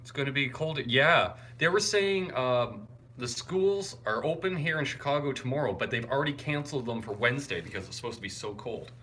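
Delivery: normal talking voice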